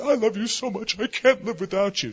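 high, gushing imitation of lovers; hammed-up romantic pleading; mock lovesick cliché. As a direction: mimic a clingy sweetheart in a high voice. Mimics what people usually say.

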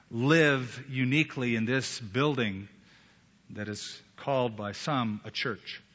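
reverent closing prayer, unhurried. Acted. Pastor praying over the congregation at the close, reverent. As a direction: speak to the Father gently, in pauses.

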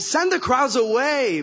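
loud, high, drawn-out exasperated order; acted frustrated outburst; frustrated and upset. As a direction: shout it slowly like fed-up disciples. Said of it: "they were mostly frustrated and, and upset".

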